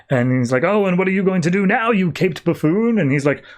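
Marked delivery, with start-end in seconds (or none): 0.6-3.0 higher-pitched theatrical jeer; retold sneering challenge, higher in pitch; mock taunt. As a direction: act out goading a captor. Captor taunted in a retold scene.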